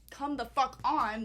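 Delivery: loud hurry-up yell; loud impatient urging. Retold or imitated. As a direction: drag them along shouting. Dragging friends while running.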